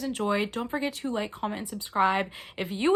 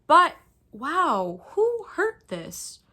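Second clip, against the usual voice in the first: high, drawn-out mock outrage; mock dismay; 7 semitones higher, 5 dB louder, much slower than usual. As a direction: gasp at the battered book like it's a crime scene. Mock horror at a damaged book.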